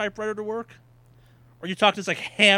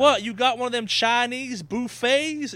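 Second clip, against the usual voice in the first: put-on Southern accent; 7 semitones higher, 4 dB louder than usual. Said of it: "why I'm going Southern with this joke".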